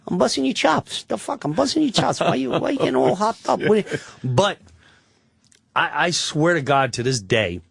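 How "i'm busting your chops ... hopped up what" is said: high, mocking tough-guy voice; ribbing him in a hammed-up wiseguy voice; mock mobster. Actor mocks the comic over quitting, in a high wiseguy voice.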